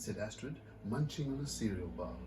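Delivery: lively picture-book reading; story narration. A narrator's reading. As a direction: read it like to a room of kids. Author reads picture book aloud.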